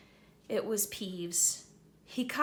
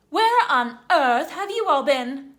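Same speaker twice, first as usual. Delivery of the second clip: much louder, higher indignant portrait; fat lady, scandalised; 6 semitones higher, 12 dB louder than usual. Acted. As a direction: scold the children for the hour. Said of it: "she asked".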